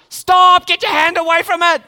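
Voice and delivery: sudden high, loud shout; parent's yell. Pretend. Parent at the hot pan yelling at the toddler, loud and high. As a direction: bark the warning to save the child's hand.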